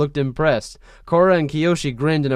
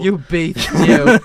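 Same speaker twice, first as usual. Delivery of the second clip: high, breathy camp drawl; flamboyant voice; 4 semitones higher, 8 dB louder, slower than usual. Retold or imitated. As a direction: ham up the camp flirt. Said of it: "he's like".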